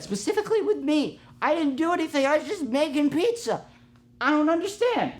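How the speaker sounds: high-pitched, put-upon whine; bewildered protest. Acted. Pizza maker baffled why cashier was upset, high whiny protest.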